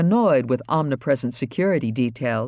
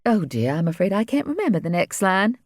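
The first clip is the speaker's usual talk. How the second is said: lightly voiced fretful speaker, higher; a hint of dithering confusion; flustered character voice; 10 semitones higher than usual. Speaker losing the line, mildly flustered.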